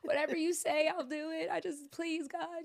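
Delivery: high pleading cry, re-enacted; retold weeping plea. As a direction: beg God through retold sobs. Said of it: "I was just weeping and weeping".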